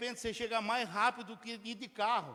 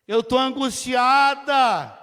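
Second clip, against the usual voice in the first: louder and slow, pleading in anguish; anguished plea; 2 semitones higher, 10 dB louder, much slower than usual. Acted. Hannah insisting to the priest Eli, anguished and slow. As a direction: let the word angustiada ache, drawn out.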